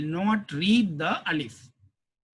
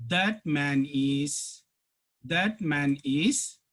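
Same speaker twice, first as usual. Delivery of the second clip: slow dictation; 3 semitones lower, much slower than usual. Shown as a demonstration. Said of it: "teacher dictating translation".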